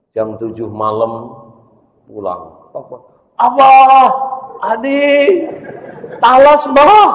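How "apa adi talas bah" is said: much higher, louder joyful calling; excited high shout. Acted. Family shouts with joy as Adi comes home. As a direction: call out in joy at his return.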